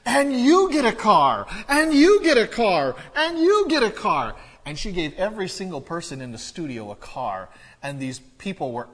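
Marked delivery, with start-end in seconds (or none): 0.1-4.3 much higher, much louder, rising with each car; Oprah shouting giveaway. Retold or imitated. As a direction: belt it out like a game show host handing out prizes. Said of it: "I remember her words from the newscast".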